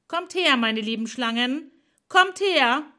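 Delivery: a loud, high summoning call. A narrator's reading. Hera calling her serpent servants, loud and high.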